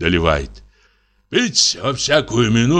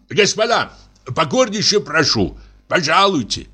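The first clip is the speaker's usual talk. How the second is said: calling guests to dinner; 7 semitones higher than usual. Acted. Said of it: "кричит".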